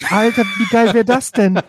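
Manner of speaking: excited shout; loud, high, thrilled; stoned. Real, not acted. Thrilled by table tennis idea.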